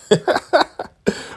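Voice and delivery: high unvoiced giggle; breathy cackle. [name] gleeful after her song, cackling. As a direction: cackle with glee.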